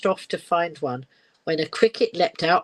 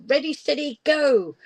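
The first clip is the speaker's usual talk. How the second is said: higher, louder call of go; starting yell; 7 semitones higher, 4 dB louder than usual. Acted. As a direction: shout it to start the race. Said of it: "She yelled".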